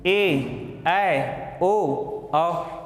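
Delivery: ए, ऐ, ओ, औ each held long; drawn-out ए, ऐ, ओ, औ, one by one; long vowels. Shown as a demonstration. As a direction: stretch ए, ऐ, ओ, औ over two beats each. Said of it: "दो मात्रा का समय लग रहा है".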